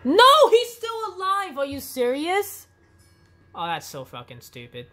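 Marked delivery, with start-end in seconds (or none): 0.0-2.7 high, loud angry yell; raging gamer. Real, not acted. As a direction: yell it in disbelief. Enemy survived.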